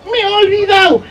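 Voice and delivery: high little excuse; sheepish child. Acted. Child excusing itself to parent. (vocecita aguda de niño regañado)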